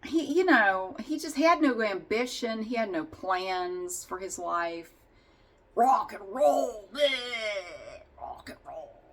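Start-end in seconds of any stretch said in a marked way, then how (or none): 5.7-9.0 lightly voiced dumb rocker, mocking. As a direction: sneer it like a burnout stuck in the eighties. Mocking Spike's rocker attitude.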